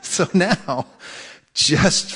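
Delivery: laughing through the words; amused laugh on the words. Real, not acted. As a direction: chuckle as you say it. Laughing after joke.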